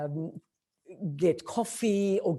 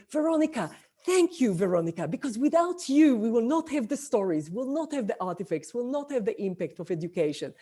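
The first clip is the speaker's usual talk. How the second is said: retold gushing thanks, pitched up; effusive reply; 5 semitones higher, 3 dB louder than usual. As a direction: return the praise emphatically, starting high and easing down. Retells own effusive thanks in reply.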